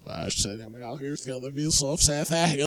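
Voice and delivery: low, soft mock-sad mutter; mock sad mumble. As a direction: grumble it like a sad sleepy guy.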